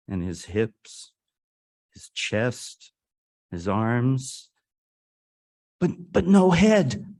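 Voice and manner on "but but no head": high, loud stammered cry of astonishment; shocked discovery. Acted. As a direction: exclaim it loud and high, in disbelief. Climax of story, shocked discovery.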